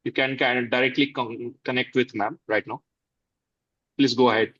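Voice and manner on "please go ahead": usual speaking voice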